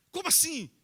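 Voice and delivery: incredulous demand. Acted. Neighbours demand which voice.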